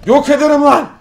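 loud, high outburst; shouted threat. Real, not acted. Gamer threatening to destroy things, shouting. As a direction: yell it as a threat at the game.

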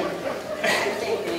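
a short, high burst of real laughter. Real, not acted. Candidate laughing at his own sign joke, real laugh.

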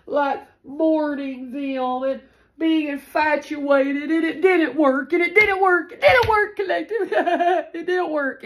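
gleeful triumph. Real, not acted. Telling viewers the enemies' love potion failed, rising gleeful crow. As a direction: crow it, breaking into a laugh.

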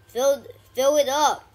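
high, loud exasperated shouting; urgent yell. Real, not acted. Player urging the rage bar to fill, yelling. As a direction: yell it in real frustration.

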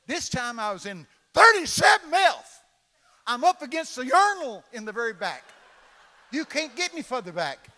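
loud, high comic rant about the back seat; loud complaint. Retold or imitated. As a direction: play up the outrage at the worst seat. Comic story, complaining loudly.

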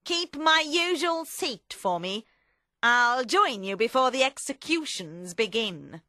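commanding character voice; Madame Defarge. Acted. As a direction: instruct firmly and high. Madame Defarge instructs Vengeance.

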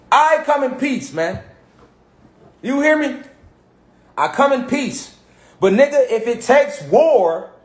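slow, deliberate declaration with pauses between phrases; clear, emphatic. Real, not acted. Speaker makes his position clear to his audience, slow and emphatic. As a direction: spell it out slowly so nobody can miss it. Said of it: "I want y'all to hear me clearly".

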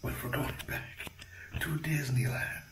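hushed excited whisper. Real, not acted. Vlogger to viewers, whispering the big reveal again. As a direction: whisper it like a secret thrill.

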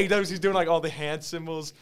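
normal talking voice

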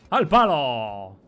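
loud, long-stretched call; drawn-out shout. Pretend. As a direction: call it out loud, dragging the vowels. Repeats it as a triumphant call.

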